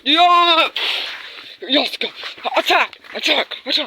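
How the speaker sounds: loud, high shouted charge; battle cry. Pretend. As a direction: yell the charge at full force. Villain cheers attack loudly.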